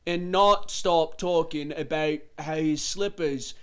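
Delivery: slow, drawn-out tavern keeper; rambling bartender voice. Shown as a demonstration. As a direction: stretch every word like a rambling barkeep. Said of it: "talked a little like this".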